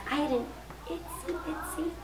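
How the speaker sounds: very soft, small timid voice; sad or scared. A narrator's reading. Lulu gulps and admits a homework problem, scared. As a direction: shrink it down nervously. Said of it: "make my voice sound like that".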